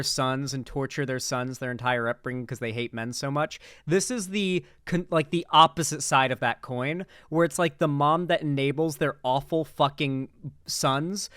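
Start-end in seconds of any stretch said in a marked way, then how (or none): none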